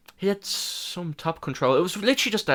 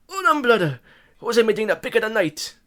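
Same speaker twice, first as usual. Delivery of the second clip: put-on Brazilian accent; 7 semitones higher than usual. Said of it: "I can't do a Moicano accent".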